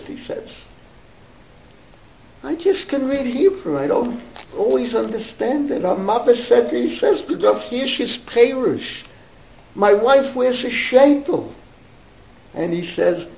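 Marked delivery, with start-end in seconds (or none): 2.4-11.5 put-on higher German-accented voice. Dentist confiding to the boy, German accent. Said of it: "with a German accent".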